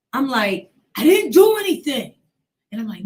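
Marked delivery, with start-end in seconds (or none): 1.0-2.1 high, loud pleading cry; desperate plea. Retold or imitated. Pinned teenager pleading with her uncle, high desperate cry. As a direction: cry out that you did nothing.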